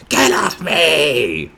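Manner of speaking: long, high, loud acted scream; worst-mood scream. Imitating a woman pushing off a cuddle, furious mock scream. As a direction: screech it out, drawn out and hammed-up. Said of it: "literally be in the worst mood".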